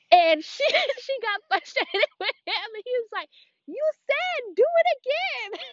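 high, breaking up with laughter; squealy laughing retelling; laughing while talking. Real, not acted. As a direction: tell it through helpless giggles. Laughing through a funny retold story.

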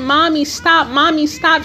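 normal talking voice

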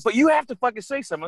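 high-pitched mock urging; imitated insistent ex. Ex urges him to speak up, high insistent imitation. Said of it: "She was".

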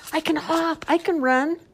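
eager bunny. Acted. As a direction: show off proudly as a little bunny. Bunny voiced in children's story.

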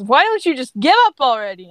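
pushy, exasperated outburst; exasperated taunt. A narrator's reading. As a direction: voice a fed-up villain mid-fight. Grape fighting Ash in story.

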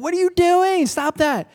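high, loud, frantic yelling; shrill-pitched outburst of a furious king; exasperated shout. Retold or imitated. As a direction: yell it like a king losing his mind. Balak exasperated at Balaam's blessings.

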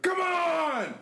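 high, frustrated mock yell; loud dad. Son imitating dad super mad at the game, loud. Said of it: "loud. or he's like".

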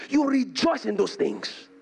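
usual speaking voice

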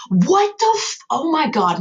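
real high-pitched outburst of dismay; aghast, cut-off cursing; exasperated disbelief. Real, not acted. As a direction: shoot up high, appalled at your own mistake. Spots own timeline blunder while reading.

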